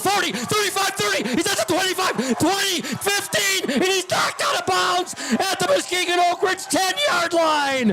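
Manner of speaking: shouting the big run; rising yell down the field; excited play call. Real, not acted.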